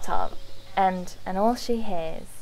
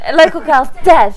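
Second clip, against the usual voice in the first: punchline; 6 semitones higher, 10 dB louder than usual. Acted. Story punchline.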